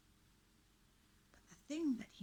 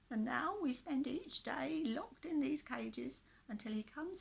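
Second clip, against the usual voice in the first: put-on high, croaky old man's voice; 9 semitones higher, 6 dB louder than usual. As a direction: plead like a frail old man. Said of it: "in a very croaky voice".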